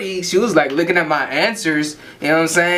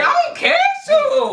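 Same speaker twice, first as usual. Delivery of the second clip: laughing high shout. Acted. Kid mocks dad defiantly.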